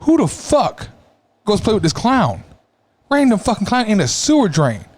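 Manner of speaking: high, disbelieving outburst; how could anyone do that; incredulous. Real, not acted. Incredulous rant about Georgie.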